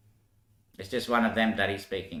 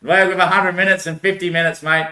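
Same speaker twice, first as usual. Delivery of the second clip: louder and higher, insisting; insistent outburst; 5 semitones higher, 5 dB louder than usual. Real, not acted. Arguing his hundred-minute hours theory, louder. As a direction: push the point hard.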